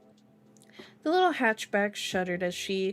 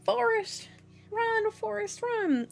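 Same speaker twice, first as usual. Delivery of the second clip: high-pitched mock calling; film quote impression; 12 semitones higher, slower than usual. Reader joking on the name Forrest, high mock cry. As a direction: pitch it way up, playfully quoting.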